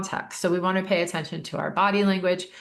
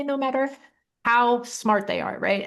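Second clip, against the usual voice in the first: ordinary talk, nothing marked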